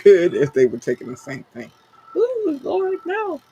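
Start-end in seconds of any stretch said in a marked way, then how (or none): 2.1-3.4 high squeal of mock horror; squealing Lord no. Real, not acted. Host reacting to her own joke about meds with a high squeal. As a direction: shriek it like you can't believe it.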